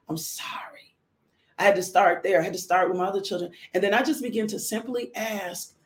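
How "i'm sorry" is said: very soft, low, almost whispered; hushed apology. Retold or imitated. Mother apologising to her older children, hushed. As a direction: let the apology drop to a hush.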